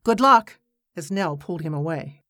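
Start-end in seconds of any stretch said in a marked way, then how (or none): 0.0-0.6 raised, louder call after her; shouting. Acted. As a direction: shout it as you're pulled away. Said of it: "he satisfied himself with shouting".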